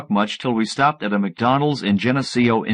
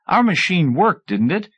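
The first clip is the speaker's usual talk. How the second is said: pitched high, rising insistence; 8 semitones higher than usual. A narrator's reading. Narrator insisting to the professor that his machine worked, voice rising. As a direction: let your voice climb as you protest. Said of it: "My voice was rising".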